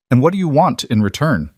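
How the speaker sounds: hard, defensive. A narrator's reading. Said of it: "Clara's voice was hard now, defensive".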